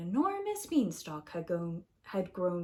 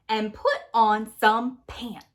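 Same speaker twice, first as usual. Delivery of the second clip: louder, slower, bossy aside; bossy narrator; 7 dB louder than usual. Acted. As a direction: tell him off firmly. Narrator scolds Jack.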